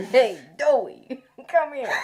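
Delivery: loud, drawn-out New York holler; mock New York accent. Host imitating the food-stall guy calling her over, mock New York accent. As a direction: call out like a hammed-up New Yorker. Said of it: "they turned into a New York accent".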